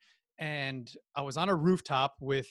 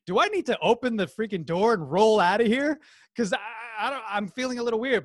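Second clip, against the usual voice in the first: louder, higher, uneasy outburst; mild mock panic; 6 semitones higher, 8 dB louder than usual. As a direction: act out wanting to escape, voice rising. Acting out his growing discomfort in car.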